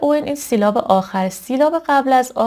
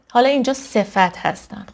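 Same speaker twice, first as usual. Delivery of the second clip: ordinary delivery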